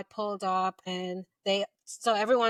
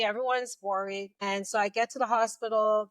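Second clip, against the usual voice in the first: normal talking voice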